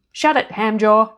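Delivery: loud, slow, firm put-down; stern fairy godmother. A narrator's reading. Fairy godmother silencing the demon Hamjaw, firm and loud. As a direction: cut the demon off sharply.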